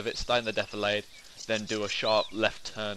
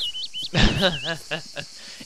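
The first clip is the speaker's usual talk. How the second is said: laughing; 5 semitones higher than usual. Real, not acted. Amused by the droid idea.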